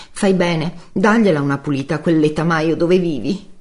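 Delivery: high-pitched, cutting female voice; scornful woman. Acted. Giulia lo punzecchia sarcastica. (voce acuta di donna, sprezzante)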